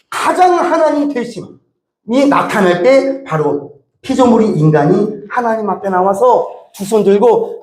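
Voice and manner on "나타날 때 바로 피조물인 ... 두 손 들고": ordinary talk, nothing marked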